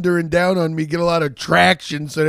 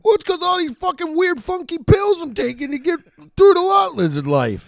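high whiny voice; 13 semitones higher than usual. Acted.

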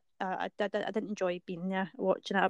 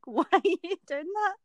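exasperated; 7 semitones higher than usual. Retold or imitated. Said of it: "I always say to him, like".

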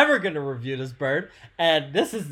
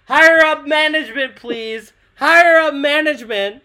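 loud, high call for the manager; 8 semitones higher, 8 dB louder than usual. Acted. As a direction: shout it across the store. Calling out for a manager.

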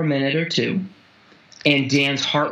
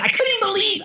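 squeaky disbelief; much faster than usual. Real, not acted. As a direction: pitch jumps way up on couldn't. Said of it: "I can't even believe I'm telling you this".

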